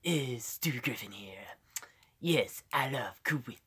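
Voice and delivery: soft, half-whispered cartoon voice; Stewie. Shown as a demonstration. Streamer doing a little impression, soft Stewie voice. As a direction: keep it hushed and put-on. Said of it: "a little bit of Stewie".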